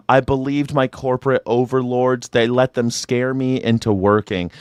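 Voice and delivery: normal talking voice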